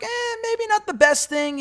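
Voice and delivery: high, slow put-on voice for a cautious friend; mock advice voice. Sonya warns Carmella off gossip, high drawn-out mock voice. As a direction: lightly lift it up high and drag it out, unsure. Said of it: "And Sonya's like".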